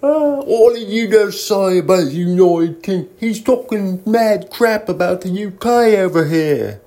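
put-on high, loud British accent, indignant; mock offended Brit. A fake Brit bristles at a question about the UK, in a mock British accent.

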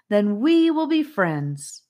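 slow, high, loud proclamation; dramatic. A narrator's reading. Hymnia declares friendship to Charlie, dramatically. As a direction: proclaim it with a grand flourish. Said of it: "returning to her dramatic tone".

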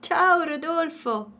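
high, slow call across the snow; shouted greeting. A narrator's reading. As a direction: call out his name from afar. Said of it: "gli gridò Agostino". (saluto gridato, acuto e lento)

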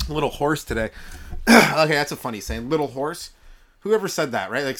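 ordinary talk

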